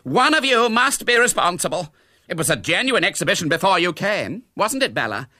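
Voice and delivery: high, loud demand; dramatic, high-pitched accusation; accusing. A narrator's reading. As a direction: round on the guests indignantly. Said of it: "Her eyes roved accusingly over her guests".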